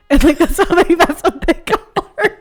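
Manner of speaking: high, loud laughing words. Real, not acted. Laughing at the accent.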